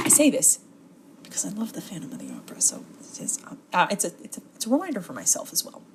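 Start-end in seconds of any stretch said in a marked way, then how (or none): 1.2-3.4 fast whispered confession; whispered aside. Real, not acted. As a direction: rush it in a hushed whisper like a secret.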